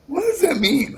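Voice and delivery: high-pitched imitation of a baffled mother; puzzled mom. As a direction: squeak the question as the bewildered mom. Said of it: "She goes".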